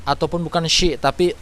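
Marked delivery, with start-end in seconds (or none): 0.6-1.0 "shi" with a hushing sh; shin sound. Shown as a demonstration. Teacher shows a mistake, "shi" with hushing sh. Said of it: "ataupun shin".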